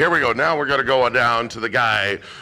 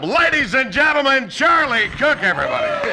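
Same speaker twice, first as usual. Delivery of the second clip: big build-up intro; 13 semitones higher than usual. Real, not acted. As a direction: sell the name big to the crowd. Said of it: "I was gonna do a big build-up".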